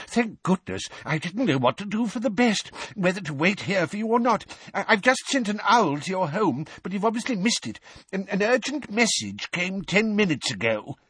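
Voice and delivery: put-on high, flustered old wizard; timid old wizard. A narrator's reading. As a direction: rush it out in nervous worry. Timid Perkins panicking.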